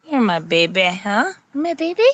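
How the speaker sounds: doting pet talk, rising at the ends. Real, not acted. Owner cooing over the dog as her baby, doting.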